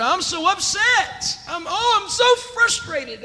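high, loud, shouted frustrated venting; mock frustrated prayer. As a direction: cry out to God in frustration. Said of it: "That's how I was praying".

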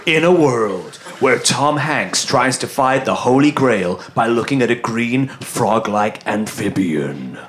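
slow, low trailer announcer; deep dramatic trailer read; movie trailer voice. Pretend. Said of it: "use the movie trailer voice".

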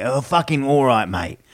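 Cockney greeting dropping low on mate; easy London geezer hello; lightly voiced East End gangster. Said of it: "that low one ... East End fucking voice".

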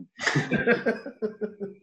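a high burst of laughter that falls away; real laugh. Real, not acted. Telling the Neil story to Mark, breaking into real laughter.

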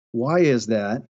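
ordinary talk

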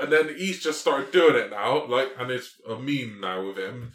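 ordinary talk

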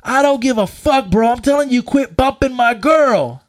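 loud, high belligerent yell; imitated aggressive boyfriend; angry man confronting. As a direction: warn him off, slowing on the last words. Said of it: "this angry man's face".

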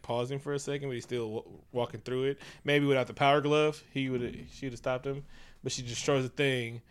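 usual speaking voice

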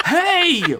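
high squealed protest; sudden high-pitched yelp; indignant squeal. Acted. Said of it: "Myrtle squealed out".

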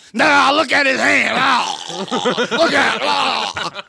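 high, slow, exaggerated mock voice; mocking Trump's mimicry. Host acting out Trump on stage, mocking imitation. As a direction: drag the words out high, as crude mimicry.

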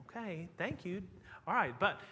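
softly put-upon, retold brush-off; mock polite irritation. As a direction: try politely to cut off the nagging. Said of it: "was just really irritated".

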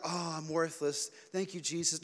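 hammed-up humble moan; mock self-pity. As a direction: play the worthless penitent, dragging it. Mocking self-pitying prayer, then says no.

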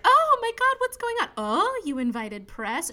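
high, loud gushing surprise; mock excitement. Girlfriend at her staged proposal, mock excited gushing. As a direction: squeal it, then draw out the last question. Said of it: "She's very excited for the publicity".

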